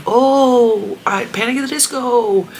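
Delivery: high swooning fangirl voice; high mock fangirl swoon; gushing teen. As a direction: gush like an obsessed teen. Imitating obsessed daughter.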